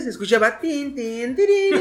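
jingle imitation. Imitates cup jingle sound.

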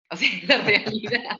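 laughing through the admission; laughing while admitting a bad temper. Real, not acted. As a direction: chuckle as you own up to it.

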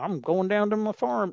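a hint of Southern politician; mock Southern accent. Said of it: "that Southern accent".

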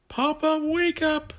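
put-on very high, loud child's cry; childish yell. Jason yells to wake his father, high loud cry. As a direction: shout it up like a small boy. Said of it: "Jason yelled".

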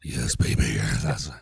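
very low, loud, rumbling seducer act; smooth creamy voice. Pretend. Co-host teased about his creamy voice, hamming up a low, smooth purr. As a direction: put on a hammed-up deep smoothie voice. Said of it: "your smooth, creamy voice".

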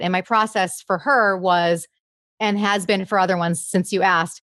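normal talking voice

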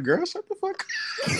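high, slow, cracking up mid-line; laughing retort. Retold or imitated. Telling off the comedian in jest, laughing hard. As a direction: squeal it through helpless laughter.

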